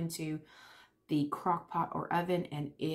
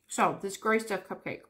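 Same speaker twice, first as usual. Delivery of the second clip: ordinary talk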